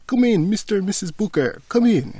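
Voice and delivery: hammed-up doorman greeting guests. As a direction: welcome them in like a grand maître d'.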